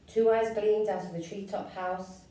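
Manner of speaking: plain reading voice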